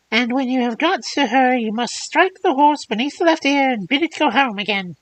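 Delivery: put-on high, thin old hag voice; old crone voice. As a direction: squeeze it out like a fairy-tale crone. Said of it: "Crone voices, they get to me".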